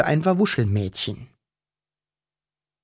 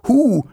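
high, loud "hoo"; short cry while plunging down; startled scream; 10 semitones higher, 6 dB louder than usual. A narrator's reading. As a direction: shriek it as if sliding away. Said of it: "schreien konnte".

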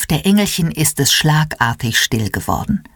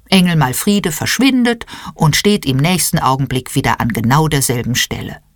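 usual speaking voice

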